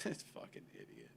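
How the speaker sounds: low, soft exasperated mutter; muttered exasperation. Retold or imitated. Both parties grumbling about the clown. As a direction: grumble it under your breath. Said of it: "both parties are like".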